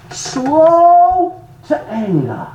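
"slow to anger" stretched out very slowly; drawn-out emphasis. Acted. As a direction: hold "slow" long and high, then ease down on "anger". Preacher dwells on the verse's key words.

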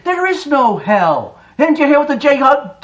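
usual speaking voice

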